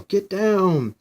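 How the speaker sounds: high, loud alarm; warning shout. Acted. Heroes warning everyone to duck the blast, urgent shout.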